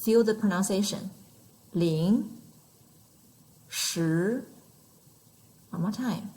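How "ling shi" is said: "Ling, shi", each gliding upward; second tone, rising. Shown as a demonstration. Teacher having learners feel the second tone, rising pitch. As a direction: lift the pitch steadily through "Ling" and through "shi". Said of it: "goes up".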